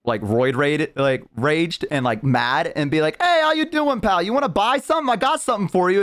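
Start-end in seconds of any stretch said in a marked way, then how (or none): 3.2-4.2 much higher, salesy Boston goblin greeting; Boston goblin accent. Retold or imitated. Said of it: "they also have, like, a Boston accent". 4.2-6.0 put-on high, pushy Boston accent pitch; Boston goblin salesman. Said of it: "they also have, like, a Boston accent".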